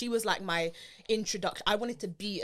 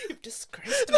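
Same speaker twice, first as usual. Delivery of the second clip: high, laughing you've disgraced me; laughing accusation; 5 dB louder than usual. Real, not acted. Cohost teasing partner while laughing hard. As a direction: tease through laughter.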